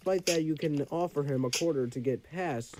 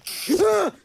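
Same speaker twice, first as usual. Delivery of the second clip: sudden loud, high scream; short high shriek; 8 dB louder than usual. Real, not acted. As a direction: scream briefly at a high pitch. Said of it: "I do not want to scream like that".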